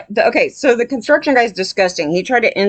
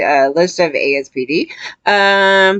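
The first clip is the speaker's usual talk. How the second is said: usual speaking voice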